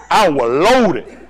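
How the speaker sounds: "loaded" slowly stretched out and loud; drawn-out emphasis. Retold or imitated. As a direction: lean long on "loaded". Comic emphasis on his state.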